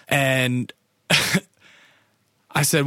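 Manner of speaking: ordinary talk, nothing marked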